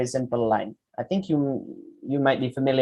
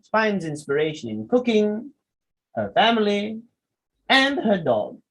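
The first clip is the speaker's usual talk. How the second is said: raised list items, clear gaps, falling finish; pauses as commas; 7 semitones higher than usual. Shown as a demonstration. Teacher showing verbal commas, pausing between high list items. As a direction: pause after "cooking" and "family", both held high, then fall on "dog". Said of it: "Some of the things you pause".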